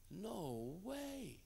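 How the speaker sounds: hushed, swooping high-pitched disbelief. Retold or imitated. Man reacting to a terribly off-key singer, hushed disbelief. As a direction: breathe out a drawn, incredulous reaction.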